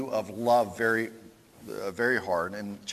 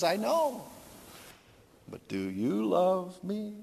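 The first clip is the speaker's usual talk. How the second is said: high, slow sung question; tender Tevye; 6 semitones higher, slower than usual. Retold or imitated. Tevye asks his wife tenderly, slow and high.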